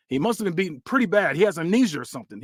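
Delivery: usual speaking voice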